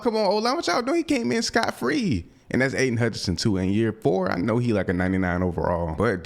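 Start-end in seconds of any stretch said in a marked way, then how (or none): 0.0-2.3 high-pitched frustrated yelp at the blockers; exasperated outburst. Real, not acted. Gamer yelling at his O-line, high exasperated yelp. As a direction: squeal in disbelief at the O-line, high. O-line lets rusher through.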